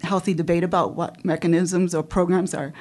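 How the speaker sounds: ordinary talk, nothing marked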